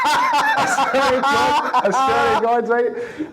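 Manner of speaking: high, squeezed words through real laughter; helpless laughter. Real, not acted. Prankster insisting the tie story is true, laughing hard. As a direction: swear it while cracking up.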